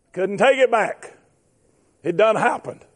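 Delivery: ordinary talk, nothing marked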